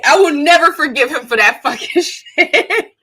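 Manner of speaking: real laugh breaking through the words. Real, not acted. Roasting an actor's accent to viewers, laughing. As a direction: crack up while declaring it.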